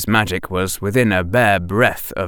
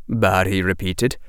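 usual speaking voice

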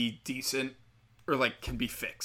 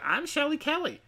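high, prissy mocking impression of her; mock girly voice. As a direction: mince the name out in a high put-on voice.

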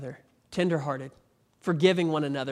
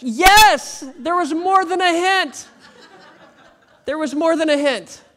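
laughing emphasis; 11 semitones higher, 10 dB louder, slower than usual. Real, not acted.

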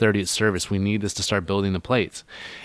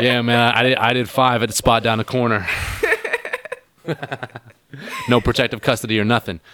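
mock hardened ex-con, a bit louder; tough ex-con; 3 semitones higher, 4 dB louder than usual. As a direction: lightly play a guy fresh out of prison. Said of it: "like a prison sentence".

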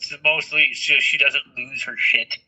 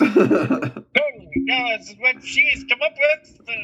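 amused; 9 semitones higher, slower than usual. Real, not acted. Laughing, joking about credit.

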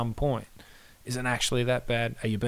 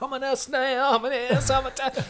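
high self-scolding voice; 13 semitones higher than usual. Retold or imitated.

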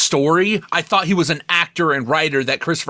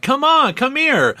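Don Johnson beckoning; 9 semitones higher, slower than usual. Retold or imitated. Imitating the father calling Marta over, hammed-up high beckon. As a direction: call her over with hammed-up fake warmth. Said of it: "you had Don Johnson like".

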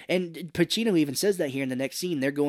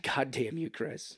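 whispered reproach, breathy and hushed; voiceless whispered accusation; bitter whisper; 5 dB quieter, slower than usual. Retold or imitated. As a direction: hiss the blame under your breath. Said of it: "she's like".

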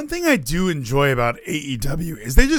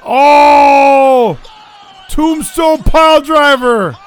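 high, loud awed ringside shout; wrestling commentator; 9 semitones higher, 6 dB louder, slower than usual. Real, not acted. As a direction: sell the big move like a commentator. Live call of a wrestling match.

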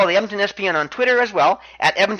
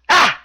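short, high, loud yelp; one sudden punched "Ah!" in imitation; Tozawa's "Ah!" cry; 8 semitones higher, 5 dB louder than usual. Wrestler answers into the mic, short high imitated yelp. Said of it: "Tozawa simply says into the mic".

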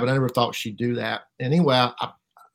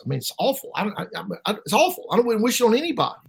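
retold upset plea, voice climbing high; distressed protest; 8 semitones higher, faster than usual. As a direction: protest with rising distress, reenacted. Retold distressed refusal to meet someone.

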